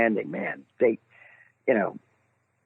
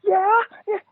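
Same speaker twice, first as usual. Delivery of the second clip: high, tight nervous yes; nervous squeak. Retold or imitated. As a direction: sound starstruck and nervous. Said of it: "And I'm going".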